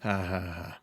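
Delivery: low, soft, deadpan fake laugh; spoken laugh with no amusement in it; sarcastic laugh. Real, not acted. Host answering co-host's teasing, dry fake laugh.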